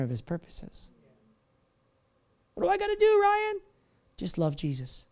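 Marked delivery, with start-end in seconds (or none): 2.5-3.6 high, eager asking voice; mock seeker. Mimicking a churchgoer asking the pastor, high and eager. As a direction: play the worried seeker asking Ryan.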